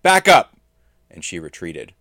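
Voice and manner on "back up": very loud, high-pitched command; sudden full-force warning; screamed. A narrator's reading. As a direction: scream it to force them back. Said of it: "but Ivor screamed".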